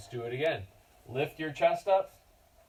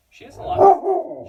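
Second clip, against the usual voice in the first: loud high talk; 14 dB louder than usual. Real, not acted. Loud high over dog noise.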